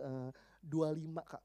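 hesitant, embarrassed admission, imitated; low and slow, reluctantly owning up; shy. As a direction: mimic a bashful girl confessing. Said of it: "dia malu-malu".

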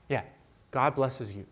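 usual speaking voice

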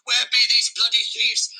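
ordinary talk

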